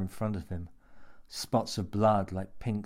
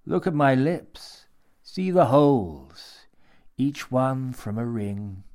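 slow creepy relish; 4 semitones higher, 7 dB louder, slower than usual. A narrator's reading. Man shows pierced lips.

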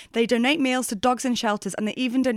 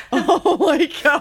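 slow laughing disbelief; shocked laughing; 7 semitones higher, 5 dB louder, slower than usual. Real, not acted. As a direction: gasp it out, laughing. Shocked at drunk story.

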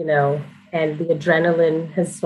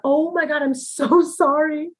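mock embarrassed apology; 10 semitones higher, slower than usual. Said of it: "And I thought".

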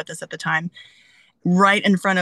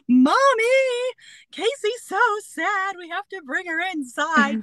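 put-on upset little girl; 13 semitones higher than usual. Little girl running in to her mom, high and pleading. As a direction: beg mommy like a heartbroken toddler. Child crying to mommy.

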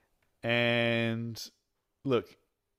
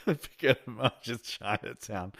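ordinary delivery

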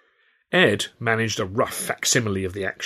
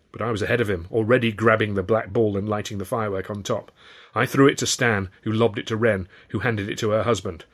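usual speaking voice

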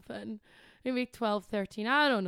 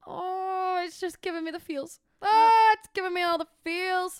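gushing; 6 semitones higher, 6 dB louder than usual. Retold or imitated. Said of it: "I used to always play it and go".

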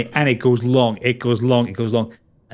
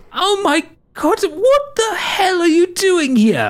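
much higher, slow astonished voice; amazed old friend; slower than usual. Acted. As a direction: gasp it out in pure disbelief. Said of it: "and he goes".

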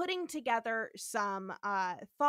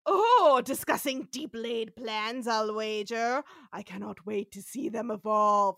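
Trelane; 7 dB louder than usual. Retold or imitated. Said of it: "he's so excited".

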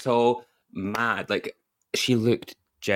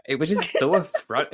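ordinary talk, nothing marked

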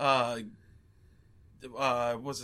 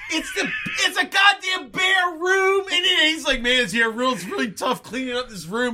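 laughing rant; 9 semitones higher than usual. Real, not acted. Friend gushing about the empty set, laughing shout. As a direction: crack up while shouting about the huge empty room. Ranting about the movie's bare room.

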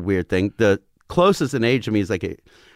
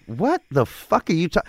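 high-pitched, incredulous reaction; retold disbelief; 7 semitones higher than usual. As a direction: replay your baffled disbelief. Retold disbelief at gator wrestling.

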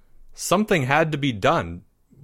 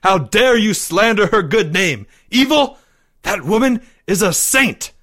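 loud, high indignation; outraged yell; 10 semitones higher, 6 dB louder than usual. A narrator's reading. Boy defending his beloved's name, loudly outraged. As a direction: thunder in defence of her honour. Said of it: "he yelled".

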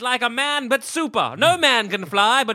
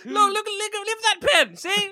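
much higher, pleading demo; high nervous pitch voice; 11 semitones higher than usual. Pretend. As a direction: plead your case in a high voice. Mock pitch to the boss.

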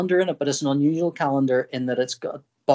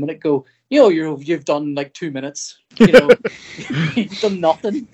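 ordinary delivery

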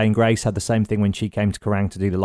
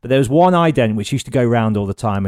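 ordinary talk, nothing marked

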